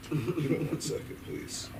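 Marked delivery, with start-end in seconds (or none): none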